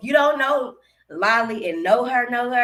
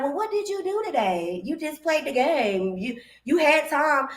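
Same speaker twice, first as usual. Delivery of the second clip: higher-pitched pushback to a partner; questioning challenge; 6 semitones higher than usual. Acted. Wife questions partner over undone dishes, challenging. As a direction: challenge your partner on their excuse.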